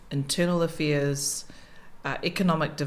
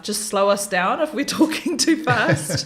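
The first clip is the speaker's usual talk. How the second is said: laughing quote; 5 semitones higher, 6 dB louder than usual. Retold or imitated. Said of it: "Had to say to my staff".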